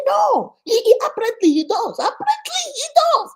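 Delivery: high strained answer. Retold or imitated. Brother admitting the husband has problems, high and strained.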